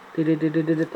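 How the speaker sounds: mouth-made camera beeps "tidit"; playful imitation of focus-confirmation beeps; imitated beeps.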